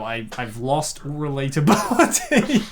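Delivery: usual speaking voice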